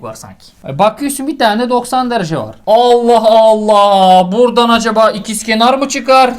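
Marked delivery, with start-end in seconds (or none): 2.7-4.3 drawn-out puzzlement. Real, not acted. Puzzling over the geometry.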